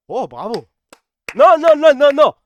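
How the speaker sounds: high, loud repeated no; stunned fan shouting no at the screen; loud protest. Real, not acted. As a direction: shout him down in disbelief. Reacting to a deleted tweet.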